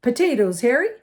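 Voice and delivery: put-on breezy older schoolboy explaining; lightly voiced Percy. A narrator's reading. Percy answering.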